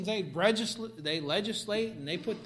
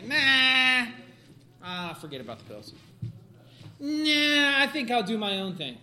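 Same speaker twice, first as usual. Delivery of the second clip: scoffing; 4 semitones higher, 9 dB louder than usual. Retold or imitated. Mocking patient rejecting pills.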